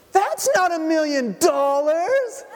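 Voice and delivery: put-on very high, slow child's complaint, half laughing; a spoiled kid protesting her gift; indignant little girl. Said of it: "she throws them and she goes".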